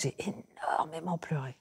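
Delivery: near-whisper, almost voiceless; hushed. Real, not acted. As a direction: let the voice drop away into breath. Recalls weeping on Gorée.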